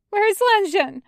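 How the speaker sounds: high, shaking with terror; shaky terrified voice. Acted. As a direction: plead in sheer fright. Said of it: "in a shaky voice".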